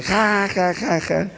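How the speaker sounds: high mocking laugh before the jab; mocking cousin laugh. Young cousin mocking her cousin's fragile masculinity, laughing high. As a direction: tease him with a high cackle. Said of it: "una primita me dice".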